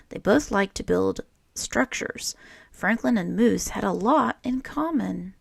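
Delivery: put-on lively read-aloud voice for children; storybook reading. A narrator's reading. Teacher reading a picture book to children, lively storytelling. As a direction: read it like story time for a class.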